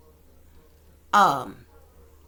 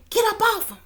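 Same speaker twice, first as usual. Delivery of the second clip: high mock scream of get up off him; shrill-pitched plea to break up the fight; woman shrieking at a brawl; imitating Jaguar screaming; 11 semitones higher, 4 dB louder than usual. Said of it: "she's saying".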